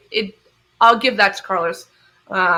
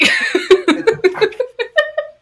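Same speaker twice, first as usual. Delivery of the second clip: high laugh; 13 semitones higher than usual. Real, not acted. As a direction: burst out laughing. Laughing at radio quote.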